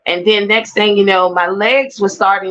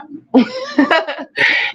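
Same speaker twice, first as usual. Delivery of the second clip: rueful laugh; 7 semitones higher than usual. Real, not acted. Laughing at her own story.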